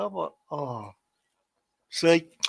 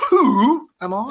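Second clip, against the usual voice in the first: quiz host question; 8 semitones higher, 6 dB louder, much slower than usual. Acted. Quiz question, high and slow.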